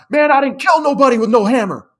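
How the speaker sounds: high, loud blurted denial; yelled outburst. Retold or imitated. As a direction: yell it out, losing control. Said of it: "unprompted just yells out".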